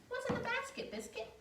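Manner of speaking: plain reading voice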